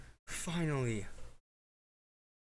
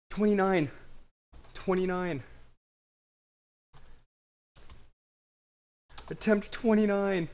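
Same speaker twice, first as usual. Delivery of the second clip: excited shout; 7 semitones higher, 5 dB louder than usual. Real, not acted. Announcing the winning attempt count.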